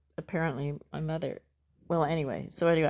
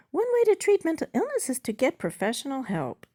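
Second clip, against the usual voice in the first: high, louder bot character; chatbot voice; 9 semitones higher, 5 dB louder than usual. Acted. Chatbot advises user on treatment, high bot voice. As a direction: give the bot's advice high up.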